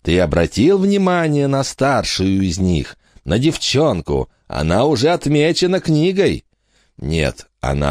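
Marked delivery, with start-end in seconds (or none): 0.0-6.4 high, cold noblewoman's voice; a sinister countess, recalled as an echo. Acted. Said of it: "Слова графини эхом гремели".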